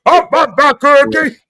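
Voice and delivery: loud, high monster singing; goofy monster song, dragged out at the end; Cookie Monster. Acted. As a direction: belt it out as a comic furry monster. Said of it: "sounding like Cookie Monster".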